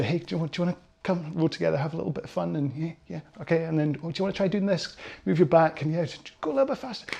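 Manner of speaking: mild-mannered, softly suggesting coach; gentle coaxing. Acted. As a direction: coax a beginner along very lightly. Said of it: "That's kind of my approach".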